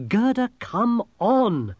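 high, drawn-out urging call; urgent call. A narrator's reading. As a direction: call a straggler to keep up. Urging a lagging companion along.

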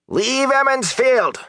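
high, drawn-out farmer's shout; angry shout. A narrator's reading. Sour-faced farmer at an Aes Sedai, angry shout. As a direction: shout it slowly and high at someone you want gone. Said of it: "the sour-faced farmer shouted".

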